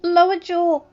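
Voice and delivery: high, halting creature whimper; high-pitched, pitiful hurt creature; pained young dragon. A narrator's reading. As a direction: squeeze out each phrase through pain.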